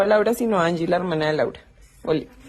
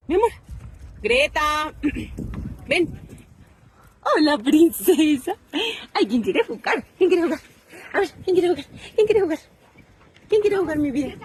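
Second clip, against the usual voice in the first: high, cooing voice climbing higher; 8 semitones higher than usual. Real, not acted. Owner coaxing her dog Greta to play, high baby talk. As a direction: coax the puppy to play in a sing-song.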